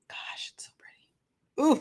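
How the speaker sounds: slow, hushed whisper of admiration; awed whisper. Real, not acted. Crafter admiring her finished card, slow awed whisper. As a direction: breathe it out, slowly, in awe.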